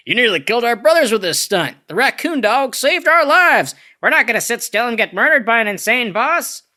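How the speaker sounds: high, put-on voice of rebelling henchmen; hammed-up high-pitched protest; defiant underlings. Acting out Pleasures rebelling against Queen.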